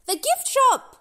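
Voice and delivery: high, loud happy shout; excited shout. Acted. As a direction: shout it, suddenly remembering. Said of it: "shouts Doc".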